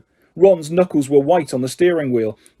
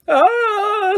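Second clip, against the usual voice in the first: startled cry. A narrator's reading. Branch punches Ron's door.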